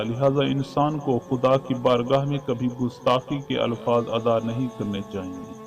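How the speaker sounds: ordinary delivery, nothing marked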